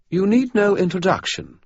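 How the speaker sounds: high, cool, formal British vampire; regal vampire. Acted. Vampire baroness greeting Dresden, cool and formal. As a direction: speak with icy poise.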